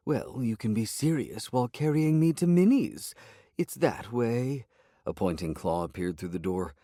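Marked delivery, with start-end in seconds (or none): 0.1-4.6 higher, bossy cat, slowing at the end; demanding cat voice. A narrator's reading. Cat demands to be carried, higher bossy voice. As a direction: order him around like royalty.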